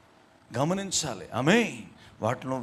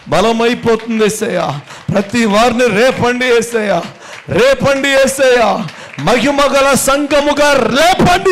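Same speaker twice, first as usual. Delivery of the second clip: much louder and higher, pleading, cries peaking; fervent shouted prayer; 6 semitones higher, 14 dB louder, slower than usual. Acted. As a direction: cry out to Jesus for revival. Loud prayer to Jesus.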